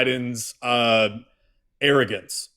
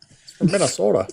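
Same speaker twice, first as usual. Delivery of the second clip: higher, hammed-up Minnesota accent; 5 semitones higher, faster than usual. Host echoing the co-host's joke, put-on Minnesota accent. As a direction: echo it in a mock Minnesota accent.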